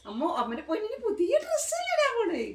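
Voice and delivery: high-pitched doting coo. Real, not acted. Mother coaxing her little one into a new dress, high baby talk. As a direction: coo high, stretching up into a squeal.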